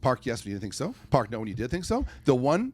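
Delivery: ordinary delivery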